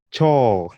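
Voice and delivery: "chaw" with an open aw, not oh; Swahili o vowel. Shown as a demonstration. Teacher modelling Swahili word for toilet. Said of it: "I'm looking for the word chore".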